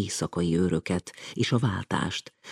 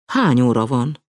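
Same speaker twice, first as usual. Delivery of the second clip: gabbled; 4 semitones higher, 6 dB louder than usual. A narrator's reading.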